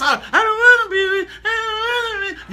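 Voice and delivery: high, whimpering mock fright; hammed-up frightened cry; mock scared whine. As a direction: parody a churchgoer recoiling in fear. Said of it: "They cuss".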